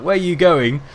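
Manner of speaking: loud shouted question; mock shout. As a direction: bark the question out. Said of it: "her terror at being shouted out".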